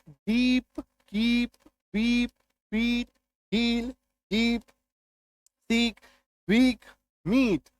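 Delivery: example words one by one, each ee held long and level, from डीप to मीट. Shown as a demonstration. Teacher drilling double-e words, long level ee from डीप to मीट. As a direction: sustain the ee in डीप, कीप, वीप and the rest, pausing between words. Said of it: "बड़ी ईकार की मात्रा".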